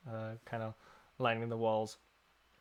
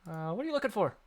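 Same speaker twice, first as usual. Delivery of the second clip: shopkeeper voice; 7 semitones higher, 6 dB louder than usual. Acted.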